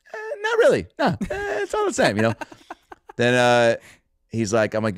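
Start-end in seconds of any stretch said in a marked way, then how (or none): 0.1-2.2 far higher, laughing dismissive tone; imitating Larry shrugging off the question; Larry David shrug. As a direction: brush it off with a chuckle.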